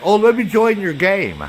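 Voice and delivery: mocking imitation of clanmate. Mimicking clanmate offering to join.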